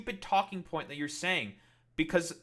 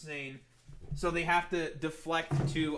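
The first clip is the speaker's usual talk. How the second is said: ordinary talk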